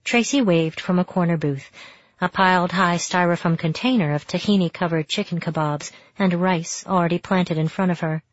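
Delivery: usual speaking voice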